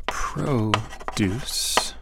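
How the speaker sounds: whispered produce, noun stress PROduce, slow; whispered to self. Shown as a demonstration. Teacher whispering while writing, quiet. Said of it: "I'll try to write it".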